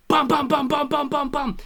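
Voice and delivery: loud, high string of bams; imitated gunshots. Player retelling full clip in Micah's head, loud imitated gunshots. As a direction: pound out mock shots in steady bursts.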